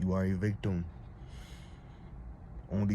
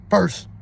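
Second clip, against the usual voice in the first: FIRST shouted out, high and loud; punched final word; 9 semitones higher, 12 dB louder than usual. Real, not acted. Telling viewers to know yourself first, shouted. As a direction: slam the word home. Climax of argument.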